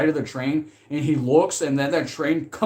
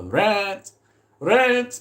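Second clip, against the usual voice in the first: mock landlord; 7 semitones higher, 4 dB louder, much slower than usual. Imitating landlord demanding rent.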